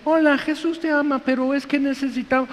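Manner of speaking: high-pitched, meek evangelist caricature. Acted. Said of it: "Y viene un cristianito". (vocecita aguda de cristianito)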